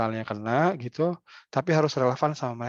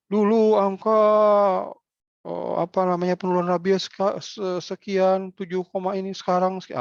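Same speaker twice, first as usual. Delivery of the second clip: higher, hammed-up bureaucrat rattling off numbers; mock health official; 7 semitones higher, 5 dB louder than usual. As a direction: mimic a stiff health officer reciting rabies statistics. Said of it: "versinya orang kesehatan". (tiruan pejabat kesehatan yang kaku membacakan angka, suara lebih tinggi)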